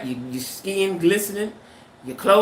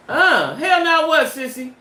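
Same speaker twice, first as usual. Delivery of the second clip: stunned disbelief; 7 semitones higher, 4 dB louder, slower than usual. Real, not acted.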